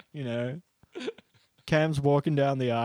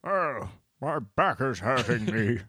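put-on very old man; slower than usual. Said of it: "He's a frail old man".

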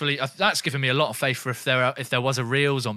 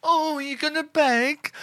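mocking taunt; 13 semitones higher than usual. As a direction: taunt a pleading victim. Taunting an imagined victim.

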